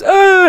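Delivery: high mock whimpering cry; imitating a blubbering little boy. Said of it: "whimpering like a little boy".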